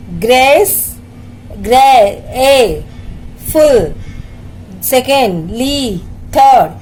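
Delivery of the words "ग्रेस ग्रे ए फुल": Grace, then फुल, as separate beats; syllable-by-syllable. Shown as a demonstration. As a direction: stretch the ए in grey, pause before फुल. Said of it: "three syllables के words".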